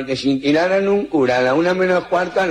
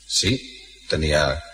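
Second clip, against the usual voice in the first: uneasy Pope; 7 semitones lower than usual. Acted. Pope low.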